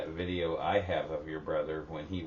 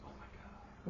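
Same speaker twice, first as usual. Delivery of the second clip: whispered amazement; soft, whispered gasp of recognition; hushed awe; 13 dB quieter than usual. Retold or imitated. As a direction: breathe it out in soft disbelief. Retelling awed sighting from the car.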